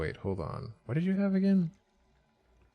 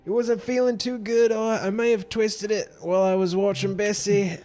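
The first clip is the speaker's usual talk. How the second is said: sheepish excuses; 9 semitones higher than usual. Acted. Beaten NPC makes excuses.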